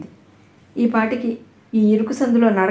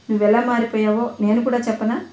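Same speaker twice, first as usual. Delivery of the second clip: ordinary delivery, nothing marked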